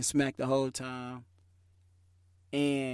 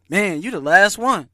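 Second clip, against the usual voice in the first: louder, tossed-off shout; calling out; 3 semitones higher, 9 dB louder than usual. Retold or imitated. Someone in line telling the teller he's last, called out. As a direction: call it over to the latecomer.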